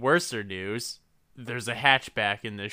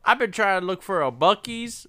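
higher, goofy lost traveller; silly high voice; 8 semitones higher, 4 dB louder than usual. Pretend. Trapped man whines to friends, goofy high voice. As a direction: whine it like a lost road-tripper.

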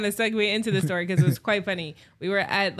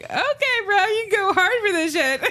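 high disbelief; 11 semitones higher than usual. Retold or imitated. Friends react in disbelief.

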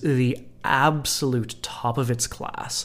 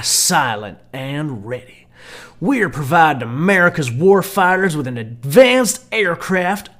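booming American military ad voice; American military accent; 3 semitones higher, 5 dB louder than usual. Shown as a demonstration. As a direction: read it like a military recruitment ad. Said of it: "my American military accent".

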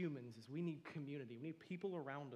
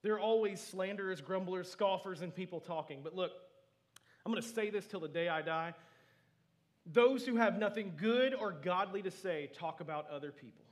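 loud, raised preacher voice; fervent preaching; 4 semitones higher, 12 dB louder than usual. Real, not acted. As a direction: preach it out with force, pitch lifted. Preaches at full force.

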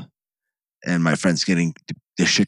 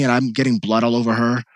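ordinary delivery

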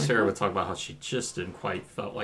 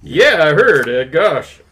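a hint of a nasal principal; lightly voiced smug school principal; 10 semitones higher, 8 dB louder, slower than usual. Reads principal Rooney role.

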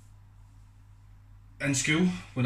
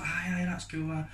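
breezy brush-off; 6 semitones higher, 3 dB quieter than usual. Retold or imitated. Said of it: "I was like".

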